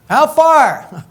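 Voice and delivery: high, pointed challenge; incredulous how far. Acted. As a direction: jump up high to demand the distance. Mock-questioning a vague runner.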